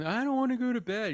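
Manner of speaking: high-pitched mock kid whine; mimicked whining. Child that evening refusing bed, mimicked whining. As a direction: imitate a child whining at bedtime. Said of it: "doing that thing called whining".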